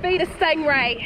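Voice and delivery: put-on a short, playful Australian accent line. Vlogger still in her Steve Irwin bit, mock Australian accent. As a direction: keep the jokey Aussie host accent going. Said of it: "That was my Australian accent".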